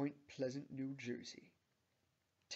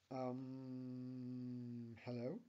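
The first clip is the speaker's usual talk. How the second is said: long, level, hesitant um; polite, awkward; much slower than usual. A narrator's reading. Tammy just entering Bob's Burgers, polite yet awkward. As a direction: stall on the um, then greet politely. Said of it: "says Tammy politely, yet awkwardly".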